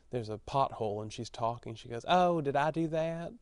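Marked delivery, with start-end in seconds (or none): 2.1-3.3 attempted Texas accent. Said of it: "not sure it was a Texas accent".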